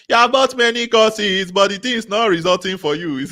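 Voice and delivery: high, loud, half laughing; excited teasing. Real, not acted. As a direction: tease the room with a grin in the voice.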